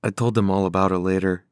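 ordinary delivery

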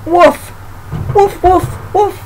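high-pitched imitated woofs; short, high storybook barks; dog barking. A narrator's reading. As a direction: woof like a dog. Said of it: "she heard a dog barking".

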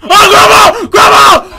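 loud high panicked shouting; panicking gamer. Real, not acted. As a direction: yell in sheer panic. Grandma chasing in game.

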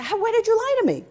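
high-pitched imitation of an angry wife; retold indignant outburst; mock mad. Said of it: "they were mad at each other".

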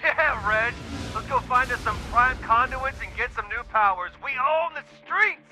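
high-pitched victory whoop building at the end; exultant. Acted. Younger brother claiming the streets after the win, exultant. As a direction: crow in triumph to your brother. Celebrates taking down the antenna.

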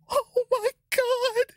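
very high, gasping, squeaky disbelief; overwhelmed shock. Real, not acted. Collector pulling a shiny Charizard, shocked high gasps. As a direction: gasp and squeal, barely getting the words out. Pulls the set's biggest card.

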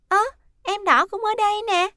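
put-on high, loud small-child voice; high-pitched childlike surprise; little girl. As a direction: point something out like a small child. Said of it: "con bé Khuê chỉ tay về phía Ben rồi nói".

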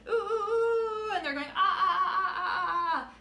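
playful chant of ooh and ah; excited shouting. Acted. Reads the shouting game page.

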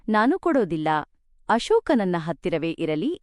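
ordinary delivery

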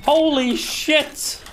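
amazed. Real, not acted. Amazed, drawn out.

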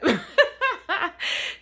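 a giggle at herself; high giggle. Real, not acted. Laughing at herself to viewers, high giggle. Laughs at her own makeup urge.

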